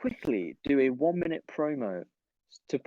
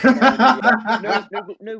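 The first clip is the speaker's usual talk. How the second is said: laughing; 7 semitones higher, 12 dB louder, much slower than usual. Real, not acted. Laughing denial.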